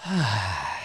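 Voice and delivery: long, breathy exhale; sigh of relief. Acted. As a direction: let out a long sigh as if the work is finally over. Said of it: "then I'll be like".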